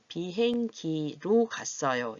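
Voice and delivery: slow, clear "비행기로 갔어요" for learners; slow model sentence. Shown as a demonstration. Teacher models the answer slowly for learners. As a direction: spell it out slowly for the class. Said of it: "이렇게 되겠습니다".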